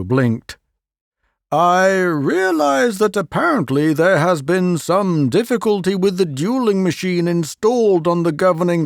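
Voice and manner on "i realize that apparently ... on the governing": lightly raised, wry professor; a hint of a pleased inventor; Leo voiced. Leo to the president, lightly wry.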